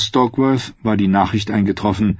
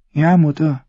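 put-on sad boy; 8 semitones higher than usual. A narrator's reading. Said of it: "antwortete Tommen traurig".

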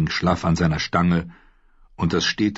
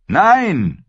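shout; 12 semitones higher, 7 dB louder than usual. A narrator's reading. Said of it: "schrie Rob".

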